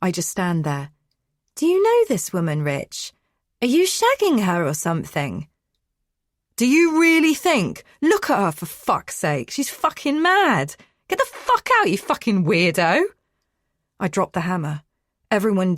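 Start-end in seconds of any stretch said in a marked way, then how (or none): 1.5-5.5 suspicious wife. Acted. Wife confronts husband about intruder. 6.6-13.0 furious yelling at an intruder; angry shouting. Acted. Man yells at intruder.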